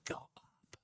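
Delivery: breathy whisper, no voice at all; whispered command. Pretend. Trader urging the price, whispered. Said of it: "and say".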